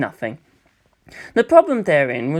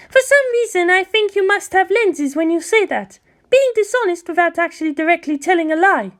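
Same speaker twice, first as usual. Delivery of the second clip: high, knowing, chiding voice; Jamyang chiding; 14 semitones higher than usual. Acted. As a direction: call him out playfully in a high voice.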